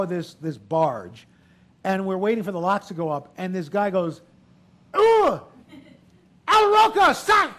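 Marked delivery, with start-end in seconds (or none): 5.0-7.5 put-on high, loud, over-the-top French accent; bad French accent, shrieked in recognition; outrageous French accent. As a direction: shout it like an excited Frenchman. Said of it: "an outrageous bad French accent".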